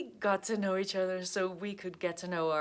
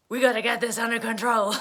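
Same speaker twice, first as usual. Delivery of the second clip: laughing bossy Leo; 4 semitones higher, 9 dB louder, faster than usual. Retold or imitated. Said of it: "be like".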